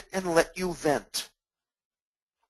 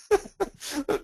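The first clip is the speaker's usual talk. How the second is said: laugh; 11 semitones higher than usual. Real, not acted. Laughing at own joke.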